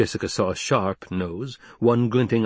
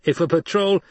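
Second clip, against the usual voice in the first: high, impatient raised voice; challenger from above; 9 semitones higher, 4 dB louder than usual. Acted. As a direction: press the leader to move on. Challenger gets cut off.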